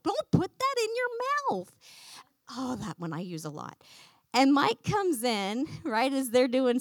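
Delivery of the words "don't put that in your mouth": higher, nagging scold; barking parent. Retold or imitated. A parent scolding kids all day long, barking. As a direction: bark it at the kids in a raised pitch. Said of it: "I'm barking".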